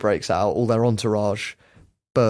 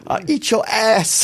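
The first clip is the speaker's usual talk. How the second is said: high, slow mock threat; mimicked rant; 11 semitones higher, slower than usual. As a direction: drag it out high, imitating a worked-up boxer. Mimicking the tearful rant just played.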